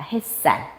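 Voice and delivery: 햇살 as 해쌀, tense ㅆ; tensed s pronunciation. Shown as a demonstration. Teacher repeating the correct sound, 햇살 with tensed ㅆ. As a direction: repeat 햇살 as hae-ssal, tensing the s. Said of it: "햇살이라고 발음합니다".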